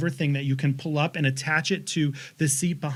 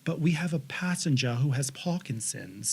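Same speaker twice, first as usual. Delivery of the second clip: mock apologetic attendant; 3 semitones lower, 7 dB quieter than usual.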